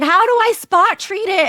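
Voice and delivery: high, loud kid's holler, imitated; calling from upstairs. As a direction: mimic a child yelling down to mom. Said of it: "she's calling me from upstairs".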